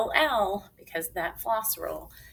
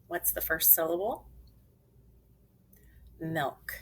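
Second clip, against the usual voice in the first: ordinary talk, nothing marked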